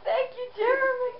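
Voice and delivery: choked up with gratitude; crying. Real, not acted. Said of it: "You made me cry".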